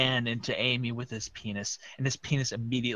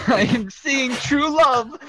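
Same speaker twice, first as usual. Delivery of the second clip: shouting; 12 semitones higher, 5 dB louder, slower than usual. Acted. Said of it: "shouted Rouge".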